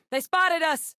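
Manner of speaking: high, urgent alarm call; high-pitched combat holler; shouted warning. Acted. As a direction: yell a warning to the squad.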